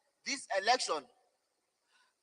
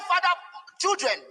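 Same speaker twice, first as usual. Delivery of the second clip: ordinary talk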